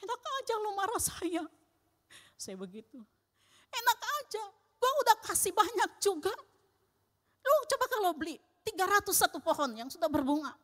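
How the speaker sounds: high-pitched indignant retort, a touch fast in the middle; snapping back at the neighbour, mock indignant; retold angry retort. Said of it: "dia teriak lagi". (membalas tetangga dengan nada tinggi, kesal)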